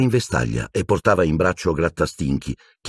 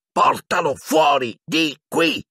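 high, slow, shouted order; roaring; 11 semitones higher, slower than usual. A narrator's reading. Ron roars at Hermione to take the cat out. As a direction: roar it at the cat's owner. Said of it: "ruggì Ron".

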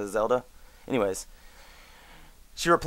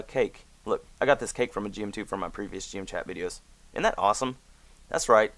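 usual speaking voice

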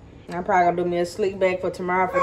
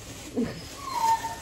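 amused laugh; 7 dB quieter than usual. Real, not acted. Laughs at own slip.

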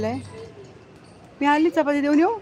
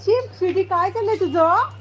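higher, shocked scolding of the dog; 6 semitones higher than usual. Real, not acted. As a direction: scold Sweety in a raised voice. (स्वीटीला उंच आवाजात रागावणे)

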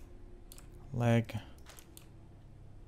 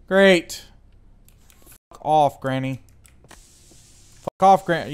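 angry yelling; 2 semitones higher, 7 dB louder, slower than usual. Real, not acted. Cursing at the ghost.

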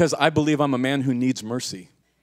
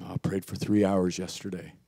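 much softer, low-pitched imitation; hushed mimicry; 7 semitones lower, 6 dB quieter than usual. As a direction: quietly imitate a devout intercessor. Mimics intercessors reporting long prayers.

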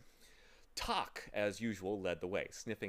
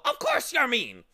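much higher, louder cutting squawk; interrupting bird; 14 semitones higher, 14 dB louder than usual. Acted. Bird interrupting the boy, loud scolding squawk. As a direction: cut him off with a scolding squawk. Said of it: "interrupted the bird".

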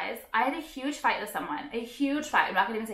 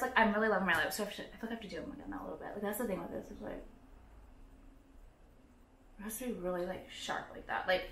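distracted murmur; 4 semitones lower, 12 dB quieter, faster than usual. Real, not acted. Fiddling with lips, distracted.

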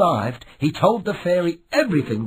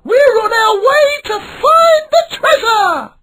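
put-on young pirate shout; 8 dB louder than usual. A narrator's reading. Milo sets sail excitedly.

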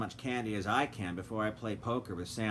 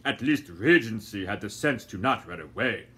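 lightly voiced gentleman; 3 semitones lower, 5 dB louder than usual. A narrator's reading. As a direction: say it unhurried, brushing her off. Doctor Fogg.

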